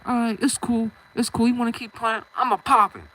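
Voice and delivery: fed up, punching out a retold threat; acted angry threat. Retold angry threat walking away.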